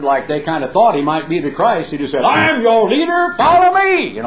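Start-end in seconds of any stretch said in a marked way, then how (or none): 2.2-4.2 high, loud mock rallying cry; rallying shout. Puffed-up leader shouting to the crowd, loud and high. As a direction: bellow it like a self-appointed leader.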